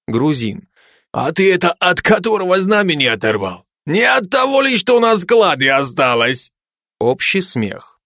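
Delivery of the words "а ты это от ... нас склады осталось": put-on higher teasing Georgian-accented voice. Georgian soldier teasing the Ukrainian about the flag, in a Georgian accent. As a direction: needle him in a Georgian accent. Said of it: "каждый со своим акцентом".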